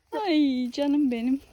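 slow, high affectionate coo. Real, not acted. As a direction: coo lovingly at a dog. Cooing at the dog.

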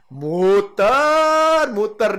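high, loud, stretched 'muter'; dizzy circling emphasis. Shown as a demonstration. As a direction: sing out 'muter' high and long. Mimics going round in circles.

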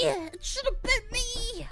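drawn-out mock wail. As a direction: stretch it out like a fake tantrum. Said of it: "I was like".

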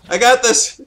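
loud, high burst of confidence; hyped-up gamer cry; excited shout. Real, not acted. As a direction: shout it with triumphant energy. Celebrates a play in game.

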